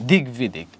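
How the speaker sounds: "দিক বিদিক" said dig-bidik; g before sounded b. Shown as a demonstration. Teacher confirming the g for students, "দিক বিদিক" as dig-bidik. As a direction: keep g and b both audible in "দিক বিদিক". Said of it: "এখানে একটা গ হবে".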